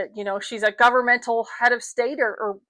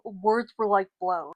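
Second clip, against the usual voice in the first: ordinary talk, nothing marked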